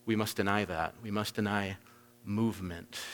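grave quiet denial; solemn low hush. Real, not acted. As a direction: drop low and quiet, weighty. Preacher drops to solemn low voice.

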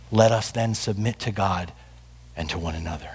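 soft, low benediction, fading to the last word; hushed closing blessing. Real, not acted. Pastor closing the sermon to the congregation, soft and reverent. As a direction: let the blessing sink to a near hush.

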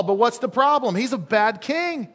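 ordinary talk

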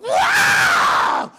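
very high, loud astonished wow; shocked cry at the doctor's mockery; huge shout. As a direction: explode with disbelief. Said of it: "I was thinking, going".

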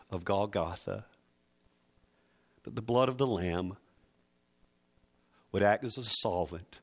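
soft, hushed prayer that fades toward a whisper. Real, not acted. Pastor praying with bowed heads, hushed prayer. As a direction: pray quietly over the people.

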